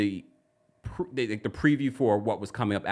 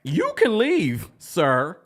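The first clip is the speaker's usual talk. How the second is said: louder, higher, slow mock dismissal; sassy slow you can leave sir; indignant retort; 5 semitones higher, 5 dB louder, much slower than usual. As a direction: slowly show him the door, outraged. Indignant mock reply to husband.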